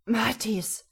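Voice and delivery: a sharp whispered warning; hissed whisper. Acted. Emil whispers to Mathis to stop, hushed warning. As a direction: whisper his name urgently.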